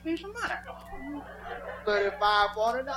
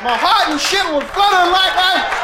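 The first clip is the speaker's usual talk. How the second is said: louder and higher, excited; loud, higher excited retelling; loud excited telling; 8 semitones higher, 6 dB louder than usual. Retold or imitated. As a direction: belt it out like it nearly killed you. Telling a wild encounter.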